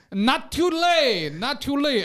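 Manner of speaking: teacher voice with a put-on Indian accent; mock Indian accent. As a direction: imitate an Indian teacher drilling the class. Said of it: "the Indian teacher".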